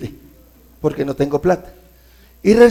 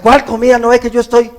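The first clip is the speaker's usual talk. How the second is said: higher, sharp exasperated retort; irritated snap; 7 semitones higher, faster than usual. Pretend. Stressed husband snaps at his wife about dinner, high and exasperated. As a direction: bite back, fed up with the question. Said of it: "estoy reaccionando conforme a mi preocupación".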